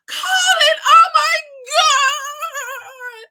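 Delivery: shrieking high and loud with delight; excited squeal. Retold or imitated. Woman spotting the man she likes, squealing with joy. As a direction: squeal his name like a fangirl. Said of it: "she was too excited to meet Colin".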